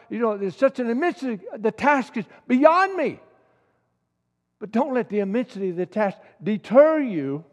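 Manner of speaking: loud, high, urgent pulpit voice; impassioned preacher pleading with the crowd; preaching at full force. Acted. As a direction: exhort them with everything you've got. Impassioned exhortation to the congregation.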